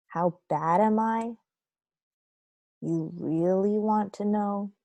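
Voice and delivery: usual speaking voice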